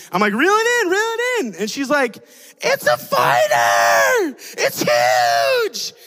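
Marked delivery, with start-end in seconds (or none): 0.3-1.5 high, eager call; excited urging. Retold or imitated. Uncle urging his niece to reel in, high and eager. 2.6-5.9 low, slow, effortful yell; straining little girl. Retold or imitated. Niece fighting the fish, slow effortful shout.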